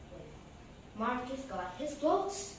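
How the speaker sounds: ordinary delivery, nothing marked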